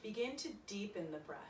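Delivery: soft, calm yoga meditation voice; quiet guided meditation for a yoga class; guided relaxation. Acted. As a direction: ease them into their breath gently.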